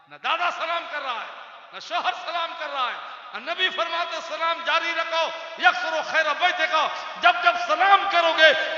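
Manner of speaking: high, loud, shouted sermon at full force; fiery preaching. Acted. Preacher shouting to the gathering to pray, at full force. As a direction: roar the call to prayer over the crowd.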